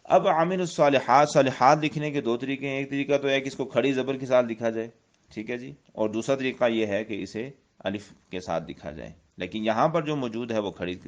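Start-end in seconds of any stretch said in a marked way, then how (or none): none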